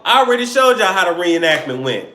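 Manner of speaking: ordinary talk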